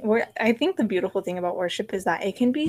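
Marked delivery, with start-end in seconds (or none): none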